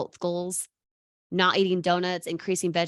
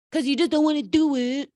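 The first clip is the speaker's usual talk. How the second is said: mocking whine; 5 semitones higher, 4 dB louder than usual. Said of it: "I make fun in that way".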